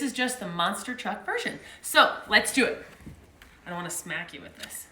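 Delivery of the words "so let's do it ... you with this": ordinary delivery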